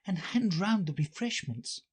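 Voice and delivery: put-on high, girlish inner voice; Alice thinking. Alice to herself in court, high musing voice. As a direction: think aloud in a high little-girl voice. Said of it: "she thought".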